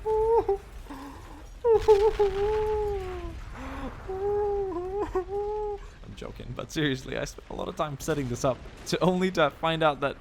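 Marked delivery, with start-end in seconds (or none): 0.0-0.6 mock shocked outcry. Gamer pretending horror as artillery wrecks his position, high mock cry. 0.7-6.0 long high laugh at his own act; high laughter. Real, not acted. Gamer laughing at his own fake panic, high and long.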